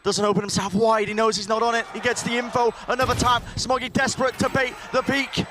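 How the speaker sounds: esports caster. Real, not acted. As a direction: ride the clutch to a shout. Live play-by-play call, hype build.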